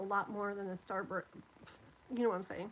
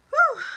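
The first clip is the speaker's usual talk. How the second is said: heavy exhale; 16 dB louder than usual. Real, not acted. Heat and tears, exhaling.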